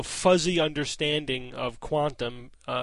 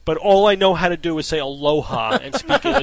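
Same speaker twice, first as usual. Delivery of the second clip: mock announcement, loud; 9 dB louder than usual. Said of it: "that's like saying".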